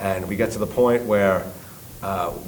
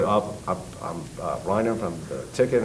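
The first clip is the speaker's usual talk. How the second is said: meek; 3 semitones lower, 7 dB quieter than usual. Retold or imitated. Host answering meekly.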